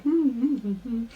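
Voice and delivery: short series of high-pitched hums; knowing hums. Acted. Bayaz avoids answering Logan, high wordless hums. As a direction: hum evasively in little rising bursts.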